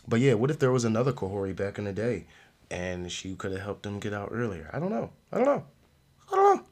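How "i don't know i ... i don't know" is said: escalating high-pitched silly uncertainty; comic squeak. Pretend. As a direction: repeat it climbing into a tiny high squeak. Repeats it climbing ever higher for laughs.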